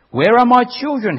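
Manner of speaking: high loud pleading cry. Acted. Abandoned man pleads for his children, loud and high. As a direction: beg desperately for the children. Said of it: "he pleaded".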